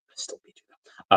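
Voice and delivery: breathy whisper of a playful boast; whispered jab. Real, not acted. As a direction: whisper it so only Ty hears. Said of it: "aside to Ty".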